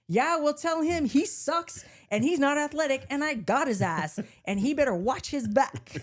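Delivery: hammed-up tough fifth grader; mock kid threat. Fifth grader threatening a rival, hammed-up slow bravado.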